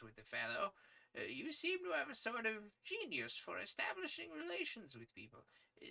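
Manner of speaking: put-on high, thin voice of a kindly old editor. Editor praising young Malone's articles, high old-man voice. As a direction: pitch him up as a fussy old newsman.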